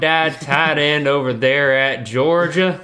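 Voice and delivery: slow, drawn-out hammed-up Cajun accent; fake Cajun accent. Cohost mimics Brian Kelly in a fake Cajun accent. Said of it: "in a really fake, um, Cajun accent".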